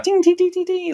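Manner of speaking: very high ringing dings; high ding sound. Shown as a demonstration. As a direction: ring it out like a bell. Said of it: "you can see it coming from a mile off".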